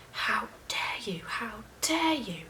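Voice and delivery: high, slow whisper-yell; mock shout. Roommate outraged at being called out, hushed mock shout. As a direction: imitate outraged screaming under your breath. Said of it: "Just shouting at me".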